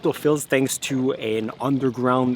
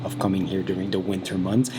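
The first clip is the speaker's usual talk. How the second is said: normal talking voice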